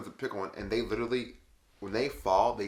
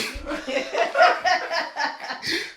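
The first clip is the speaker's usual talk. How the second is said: long high cackling laugh; real laughter; 7 dB louder than usual. Real, not acted. Storyteller cracking up at her friend feeling gassy, big laugh. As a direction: lose it laughing, high and loud.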